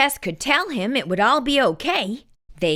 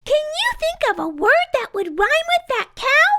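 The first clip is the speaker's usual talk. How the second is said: bright Tess. A narrator's reading. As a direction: cheer your friend up in a squeaky bright voice. Tess coaxing.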